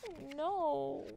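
soft little lament; hushed dismay. A narrator's reading. Narrator reacting to the broken kite string, hushed dismay. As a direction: say it softly, with concern.

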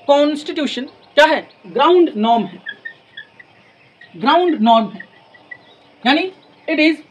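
normal talking voice

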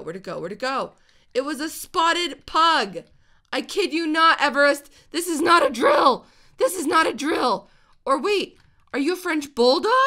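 loud and high, thrilled; excited. Real, not acted. Gamer tells Everest about a rare dog, thrilled shouting. Finds rare spotted pug.

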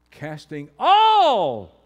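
"all" shouted long and high; loud, stretched "all"; stress on all. Real, not acted. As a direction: swell into a huge, drawn-out "all". Preacher hammers the word all.

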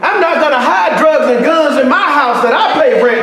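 rising shout from the pulpit; preaching at full force. Acted. Pastor preaching to the congregation, shouting.

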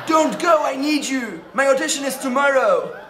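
high, pleading, laughing voice. Acted. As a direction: beg them to stay in a high voice. Begging teacher in a high voice.

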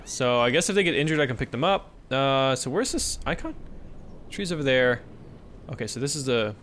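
ordinary talk

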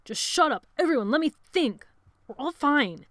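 loud, high, exasperated order; harsh command. A narrator's reading. Sebastian quieting the frightened boys, harsh loud command. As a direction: shout them down harshly. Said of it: "Sebastian's harsh command".

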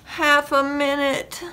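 high-pitched plea from bed; pleading farmer. A narrator's reading. Farmer bargaining to stay in bed, pleading.

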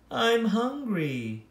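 a hungry little crybaby, whimpering slowly; whiny crybaby. Acted. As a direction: drag out the complaint like a crybaby. Hungry crybaby character whines.

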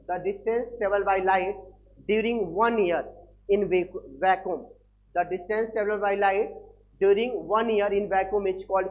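slow, word by word, for students to copy; slow dictation. Shown as a demonstration. Teacher dictating the light-year definition to students, slow. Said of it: "तो क्या बोलोगे".

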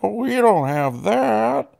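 high-pitched drawn-out wail; slow, hammed-up swooping whine; mock wail. Imitating characters hearing the mirror's price, mock wail.

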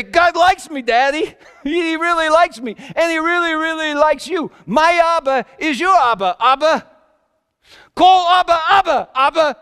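high, loud, laughing joy that builds to a shout; joyful outburst. Retold or imitated. Son dancing and telling his father God likes him, laughing joyfully. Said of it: "he'd look at me and he'd say".

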